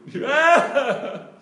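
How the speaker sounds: high, loud yelped cry, put on; imitated little cry. Girl crying out each time it moves, imitated loud yelp. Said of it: "she would do this little cry".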